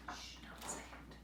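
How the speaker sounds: shushing whisper. Real, not acted. Keeping the secret from children, whispered. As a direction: hush them playfully. Hushed aside to listeners.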